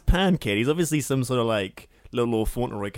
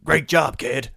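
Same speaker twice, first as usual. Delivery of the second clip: loud, gruff captain impression, raised pitch; mock Hook bark; 4 semitones higher, 6 dB louder, slower than usual. Mocking the actor who yells every line as Hook. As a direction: bark it like a brooding pirate captain. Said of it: "yells every line".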